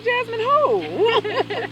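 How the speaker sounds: very high, slow, loud disbelief; can't believe what she's hearing. Retold or imitated. Interviewer retelling her shock at the news, very high disbelief. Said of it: "And I was like".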